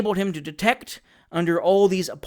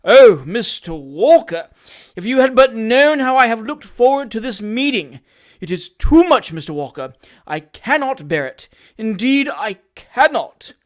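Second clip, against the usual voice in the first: fawning hypocrite; 5 semitones higher, 8 dB louder than usual. Acted. Job Trotter gushes false feeling to Sam, fawning voice. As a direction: overdo the heartfelt emotion.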